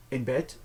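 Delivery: bed said as "bet". Shown as a demonstration. Teacher repeats the word after the rule, D as T. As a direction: turn the final D of bed into a T. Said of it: "the D turns into a T".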